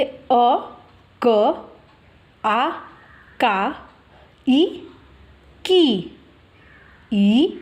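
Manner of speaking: drawn-out letter recitation with pauses; syllable drill. Shown as a demonstration. Teacher shows learners how vowels join क, measured syllable drill. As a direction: sound out each syllable separately, holding the vowel. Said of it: "उसमें अ की उच्चारण आती है".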